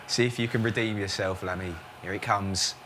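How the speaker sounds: low, soft, tense commentary; hushed build-up. Pretend. Commentator teasing Lammy before the ball, low and hushed. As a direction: lean in and whisper the suspense.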